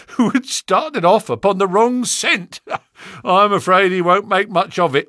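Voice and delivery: laughing. Acted. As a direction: chuckle at a rival's failure. Gregson laughing at rival.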